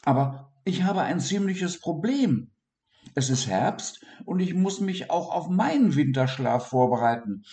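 high worried little voice; high pleading dormouse. Acted. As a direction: explain the trouble earnestly. Dormouse explains his problem.

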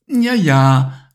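mother brushes off her son's claim; dismissive yes yes. Acted. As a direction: humour the child. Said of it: "erwiderte Mama".

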